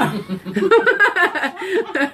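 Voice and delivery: a burst of high laughter at her own joke; cracking up at her own joke; hearty laugh. Real, not acted.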